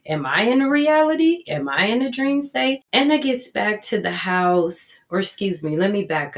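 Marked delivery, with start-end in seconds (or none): 0.0-2.8 confused. Real, not acted. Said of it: "So I'm kinda confused right now".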